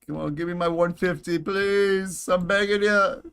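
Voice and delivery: high, whiny pleading; whiny begging. Real, not acted. Begging the game for resources.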